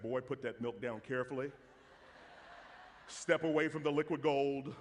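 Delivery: low, measured mock standoff voice; mock stern warning. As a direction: talk him down like a tense standoff. Warns son off pricey milk.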